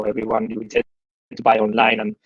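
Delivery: ordinary delivery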